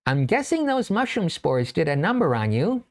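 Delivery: a hint of the little mouse. Mouse speaks to narrator.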